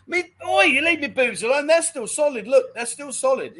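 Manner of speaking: high, hammed-up oi, leave me alone; playfully outraged, pitch jumping up; mock indignation. Telling viewers off over his boobs, mock indignant.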